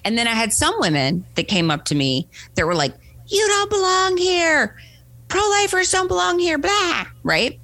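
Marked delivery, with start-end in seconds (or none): 3.3-7.0 mock angry yelling. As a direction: imitate a protester hollering someone away. Imitating hostile women at the march.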